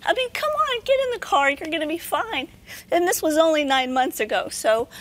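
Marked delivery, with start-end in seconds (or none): 0.0-2.5 high, breezy reassurance; coaxing a scared husband into the car, airy and high; brushing off worry. Retold or imitated. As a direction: wave away his fear lightly. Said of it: "And I'm like".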